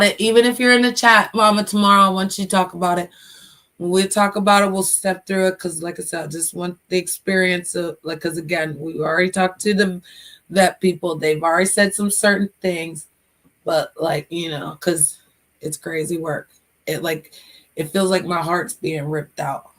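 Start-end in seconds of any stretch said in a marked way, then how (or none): none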